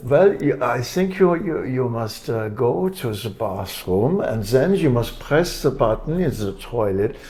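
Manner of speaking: put-on Austrian German accent, th as z. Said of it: "put on a German accent, or an Austrian German accent".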